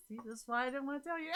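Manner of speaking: high, quick mock retort; amused, hurried imitation of herself; playful self-mimicry. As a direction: rattle it off high in a silly voice. Repeats own retort, laughing, mocking herself.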